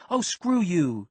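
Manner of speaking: loud, high angry yell; girl snaps at him in the car, yelling. Acted. Yell it out, furious. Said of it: "she yelled out loud".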